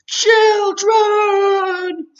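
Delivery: long, high, booming call; Zeus summoning the children; window-rattling shout. A narrator's reading. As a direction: bellow it across the playground. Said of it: "said in that window-rattling voice".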